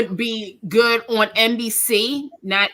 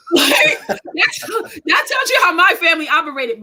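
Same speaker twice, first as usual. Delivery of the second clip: excited laughing shout; 6 semitones higher than usual. Real, not acted. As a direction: burst out laughing. Laughing shout about family.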